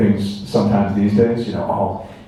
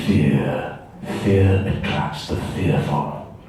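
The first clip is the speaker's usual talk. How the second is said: low, slow, hushed sinister villain voice; Darth Maul; 6 semitones lower, 5 dB quieter, slower than usual. Retold or imitated. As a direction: intone each phrase darkly, with menacing pauses. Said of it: "Darth Maul had one where he goes".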